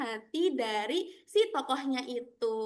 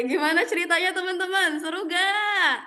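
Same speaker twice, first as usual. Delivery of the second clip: higher, louder eager asking; excited question; 4 semitones higher, 5 dB louder than usual. Real, not acted. Teacher excited asking children. (bertanya dengan semangat)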